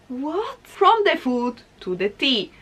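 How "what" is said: soft, rising "what?"; quietly baffled, pitch climbing; hushed disbelief at what they see; baffled reaction. Acted. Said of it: "I'm like".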